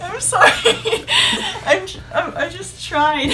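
high, loud, helpless laughter while apologizing; laughing apology. Real, not acted. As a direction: apologize through real giggles. Laughing apology after being teased.

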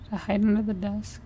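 ordinary delivery

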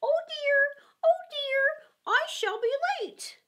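high, hurried, anxious rabbit voice. A narrator's reading. Rabbit fretting to itself about being late. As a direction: squeak it out like a flustered rabbit. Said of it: "hear the rabbit say to itself".

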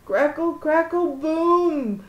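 rising loud thunder crackle and boom; bellowing thunder. A narrator's reading. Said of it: "the thunder bellowed".

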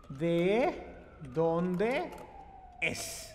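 low "De ... dónde ... es" with pauses between words; word-by-word modelling. Shown as a demonstration. Teacher showing the usted form, word-by-word modelling. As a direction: space out "De", "dónde", "es" for learners.

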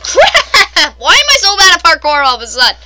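loud, high, laughing exasperation; frustrated outburst. Real, not acted. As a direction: complain loudly and quickly after a fail. Fails at parkour again.

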